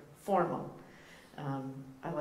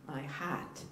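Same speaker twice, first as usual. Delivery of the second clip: Boston accent, "heart" said with the r dropped; 5 dB quieter than usual. Shown as a demonstration. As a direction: say "heart" slowly and softly the Boston way, like "haht". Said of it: "that's my Boston accent".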